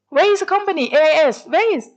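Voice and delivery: high, loud frantic question; frantic asking. Retold or imitated. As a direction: call out lost and hurried at the empty gate. Lost at the gate, frantic.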